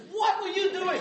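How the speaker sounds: high, loud, incredulous scolding; hammed-up indignant yell; mock outraged caller. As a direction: act out an appalled caller for laughs. Acting out angry phone caller.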